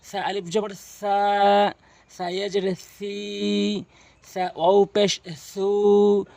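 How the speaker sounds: letter names recited, then a drawn-out "সা", "সি", "সু"; madd spelling drill. Shown as a demonstration. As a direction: spell it out, stretching the final "সা", "সি", "সু". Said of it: "তাহলে পড়বেন এভাবে".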